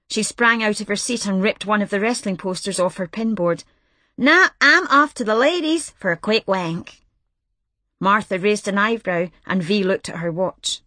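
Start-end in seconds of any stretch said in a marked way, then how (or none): 4.2-7.0 brassy V. Acted. Brash reporter V teasing the new girl, sassy.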